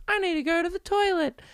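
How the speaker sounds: high, tiny talking-doll voice; little baby voice. Retold or imitated. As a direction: squeak it out like a toy doll needing the potty. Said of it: "it says in a little baby voice".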